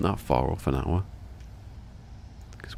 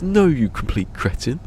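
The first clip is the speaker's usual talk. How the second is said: mock insult; 8 semitones higher than usual. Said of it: "He goes".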